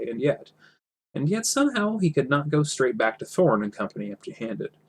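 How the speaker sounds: usual speaking voice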